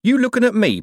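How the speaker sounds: high, loud challenge; menacing cheeriness. A narrator's reading. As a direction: pick a fight with false cheer. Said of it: "the cheery of the word pal".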